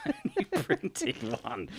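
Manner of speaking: laughing. Real, not acted. Laughing mid-sentence.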